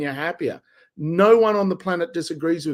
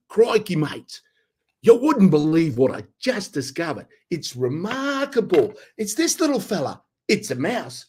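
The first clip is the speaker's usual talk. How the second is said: put-on excited Australian wildlife presenter accent, pitched up; Australian nature guy; 4 semitones higher than usual. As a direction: marvel at a find like a bush TV host in an Australian accent. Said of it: "the Australian nature guy".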